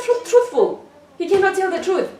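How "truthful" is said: usual speaking voice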